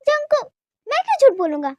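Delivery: high, loud defensive outburst; indignant protest. Acted. Protests accusation of lying.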